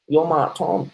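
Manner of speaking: "Mart" in broad bogan style, open AH for "mate"; high-pitched bogan address, "Mart" said like "art" with M. Shown as a demonstration. Streamer naming himself bogan-style, "Mart" with broad AH. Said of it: "That's a real Bogan way of saying it".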